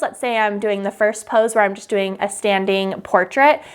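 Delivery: normal talking voice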